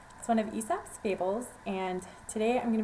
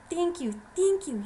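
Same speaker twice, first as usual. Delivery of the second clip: high, louder excited thanks; grateful gushing; 8 semitones higher, 5 dB louder than usual. Acted. Mouse thanks the lion for sparing her, gushing. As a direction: burst out with relieved thanks.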